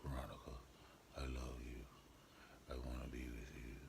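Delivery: low, soft breathy seduction voice; David's whispery lover voice. Retold or imitated. David whispering low and seductive to Veronica. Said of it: "let me really do it".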